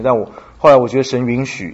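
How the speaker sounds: ordinary delivery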